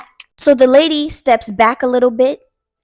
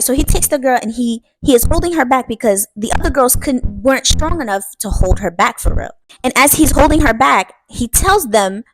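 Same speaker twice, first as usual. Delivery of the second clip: ordinary delivery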